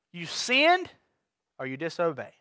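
dropped to a hushed, low "or you disobey"; quiet delivery. Shown as a demonstration. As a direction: let "disobey" sink soft and low. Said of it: "Ooh, got quiet".

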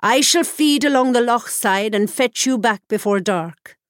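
commanding crone. A narrator's reading. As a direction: give the order like a stern old witch. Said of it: "commanded the Cailleach".

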